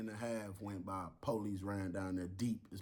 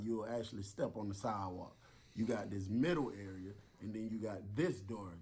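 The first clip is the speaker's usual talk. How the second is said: normal talking voice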